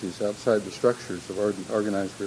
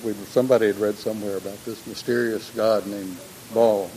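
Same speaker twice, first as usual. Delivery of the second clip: ordinary delivery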